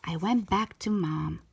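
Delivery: ordinary delivery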